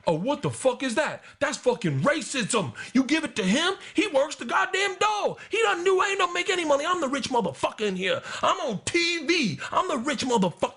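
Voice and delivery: high, shouting outrage in a put-on voice; mock furious rant. Tracy yelling at the waitress over the bill, high furious shout. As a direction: yell it like a celebrity flipping out.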